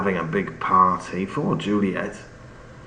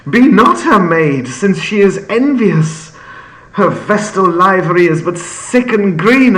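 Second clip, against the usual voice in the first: higher and louder, building to a hushed end; Romeo's rising passion; 7 semitones higher, 7 dB louder than usual. Acted. Teacher reads Romeo's balcony speech, rising passion. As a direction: declaim it like a lovestruck poet. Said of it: "He goes on".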